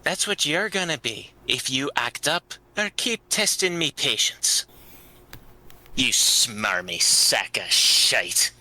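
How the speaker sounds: put-on threatening accented character voice, slowing on the insult; feisty human fighter. Human warning the elf not to test them, menacing. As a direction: menace him, dragging out the final insult.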